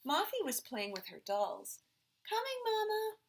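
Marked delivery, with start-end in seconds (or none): 2.3-3.2 put-on much higher child's voice; little girl answering. A narrator's reading. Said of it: "Mothie said".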